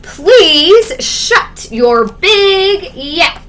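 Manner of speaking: exasperated crowd whine; fed-up townsfolk. Acted. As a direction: beg him to stop, at wit's end.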